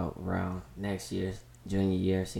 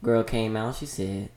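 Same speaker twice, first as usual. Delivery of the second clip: usual speaking voice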